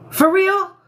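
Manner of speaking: squeaky, high, loud incredulous "For real?"; funny for real. Real, not acted. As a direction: pitch "For real?" way up in mock disbelief. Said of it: "I know I'm saying that funny".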